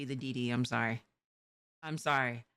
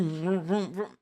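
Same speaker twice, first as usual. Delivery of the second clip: mimicked car engine noises; loud playful engine sound; engine vroom; 4 dB louder, slower than usual. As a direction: make the vroom of a speeding car. Imitating speeding car.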